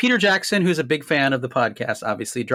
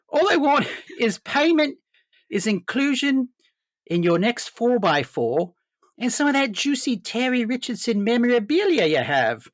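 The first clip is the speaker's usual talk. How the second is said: put-on higher Kiwi accent, Peter Jackson; put-on New Zealand voice, higher; 10 semitones higher than usual. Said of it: "a very objectionable New Zealand accent".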